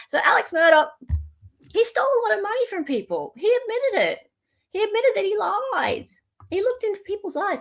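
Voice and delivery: high-pitched, insistent mock outrage; high and swooping, each point landing with a fall; mock indignation. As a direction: pile up the charges in a high voice. Mock indignant list of admissions.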